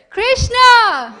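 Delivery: very high, full-volume shout; long hailing cry; loudest call. Acted. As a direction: shout his name with all your strength. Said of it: "will come louder".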